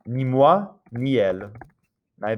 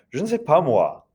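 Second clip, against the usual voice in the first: higher run through the phrase, falling onto "moi"; stress on moi; 5 semitones higher than usual. Shown as a demonstration. Teacher showing how French people say it, emphasis on "moi". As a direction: run "Je ne sais pas" together, set down "moi". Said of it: "They say it just like that".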